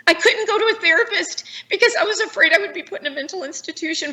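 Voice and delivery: fighting back tears while telling it; near tears. Real, not acted. Survivor to interviewer, voice cracking high with tears. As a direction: voice breaking high, close to crying. Emotional testimony of abuse and hiding.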